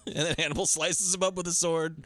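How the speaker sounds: ordinary delivery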